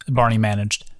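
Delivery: ordinary delivery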